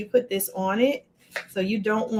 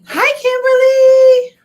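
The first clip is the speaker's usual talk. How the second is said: much higher and louder, slow hello; excited greeting; 14 dB louder, slower than usual. Real, not acted. Host spotting a viewer in chat, loud excited hello. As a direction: call out a delighted hi.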